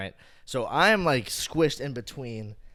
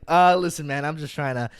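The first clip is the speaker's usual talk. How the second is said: higher, loud, hesitant appeal; flustered placating; 6 semitones higher, 7 dB louder than usual. Retold or imitated. Crowded man to an angry fan, flustered. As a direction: try to get a word in.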